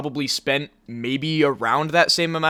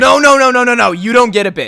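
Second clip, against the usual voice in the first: shouting no; 6 semitones higher, 10 dB louder than usual. Real, not acted. Refusing friend, shouting over.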